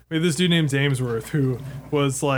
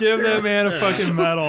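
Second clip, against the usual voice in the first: laughing; 5 semitones higher than usual. Real, not acted. Laughing reaction to the story.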